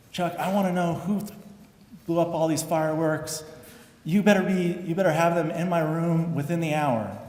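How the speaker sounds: furious coach. Retold or imitated. Coach threatening the team over fireworks, retold mock anger. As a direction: bark the ultimatum like an angry coach.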